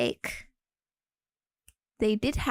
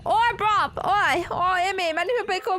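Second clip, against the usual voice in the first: higher, louder mock British chav shout; hammed-up British accent; 5 semitones higher, 4 dB louder than usual. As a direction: yell it like a cartoon British lad. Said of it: "do people actually speak like this".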